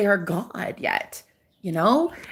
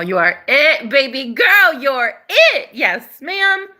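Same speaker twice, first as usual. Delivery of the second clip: high, loud cheerleading; excited, higher and louder encouragement; excited hype; 6 semitones higher, 5 dB louder, slower than usual. Real, not acted. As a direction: pump her up like a best friend. Hyping up the viewer.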